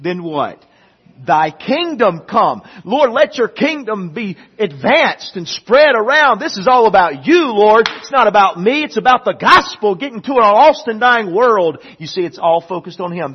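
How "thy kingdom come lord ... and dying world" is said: loud, high preaching; preaching at full force. Acted. Preacher exalting God's kingdom to the church, loud preaching. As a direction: cry it out to the Lord.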